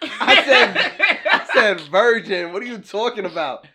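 indignant outburst, mock-offended and laughing; loud laughing protest. Real, not acted. Virgin jokester protests to teasing friends, loud laughing protest.

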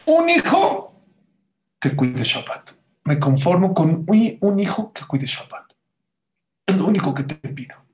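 impassioned cry, leaping high and loud; acted tearful plea. Rabbi begging Hashem for one son, acted tearful plea. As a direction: beg desperately as if about to weep. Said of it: "ahí es donde empezó a llorar".

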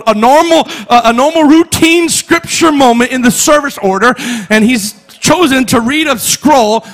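high, loud, shouted preaching; full-force preaching. Real, not acted. Pastor preaching to his church, full-force shouting. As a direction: preach it to the rafters.

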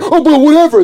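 high, loud mock brush-off; mocking guilty dismissal. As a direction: sneer it like a guilty person waving it away. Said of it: "that's how guilty people argue".

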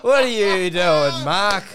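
slow, loud mock outrage; mock indignant. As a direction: ham up the indignation. Playing the accused to Mark.

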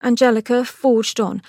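plain reading voice

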